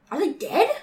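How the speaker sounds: ordinary talk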